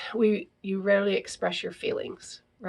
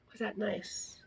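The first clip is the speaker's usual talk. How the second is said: hushed repeat; 12 dB quieter, slower than usual. Shown as a demonstration. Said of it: "contrasting vague questions".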